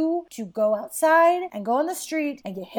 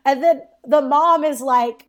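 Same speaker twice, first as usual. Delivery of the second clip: usual speaking voice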